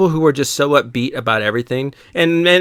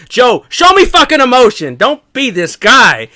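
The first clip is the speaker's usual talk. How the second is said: higher, louder demand, slowing at the end; exasperated yell; 9 semitones higher, 5 dB louder, slower than usual. Retold or imitated. To Joe, demanding real emotion, exasperated shout. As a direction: shout at Joe to show some feeling. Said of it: "And I was like".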